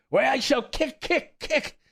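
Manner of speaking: very high, rising defiance; furious cry. A narrator's reading. Colt kicking the stall, shrieking defiance. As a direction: shriek it, kicking each word. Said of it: "each time he uttered the words".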